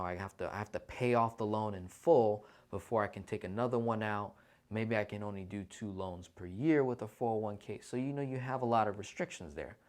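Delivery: ordinary talk, nothing marked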